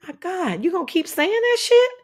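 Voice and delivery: an incredulous, high-pitched, mocking retort; exasperated disbelief. Quoting herself to a housemate, incredulous and high. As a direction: voice jumps high in outraged disbelief. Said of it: "I said".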